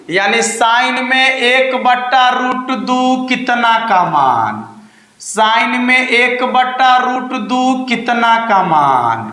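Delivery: slowly spelled-out question; slow dictation. Shown as a demonstration. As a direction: read it out slowly for the class. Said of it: "मान लो sin inverse".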